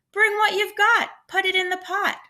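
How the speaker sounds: rally the villagers to bring food; eager calling out; shouted. A narrator's reading. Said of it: "shouted the travelers".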